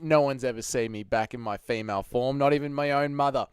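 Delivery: ordinary delivery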